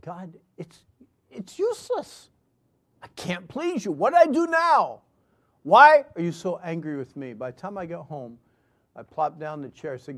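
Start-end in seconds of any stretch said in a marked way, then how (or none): 0.0-7.3 high, strained cry building to a shout; anguished outburst. Acted. Man crying out to God on a walk, anguished outburst. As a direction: start choked, then burst out loud and high. Acted anguished prayer on the road.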